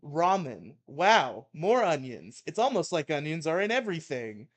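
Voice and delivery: loud mock-angry reading; ranting article read. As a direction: belt out the rant. Reads Maddox rant aloud.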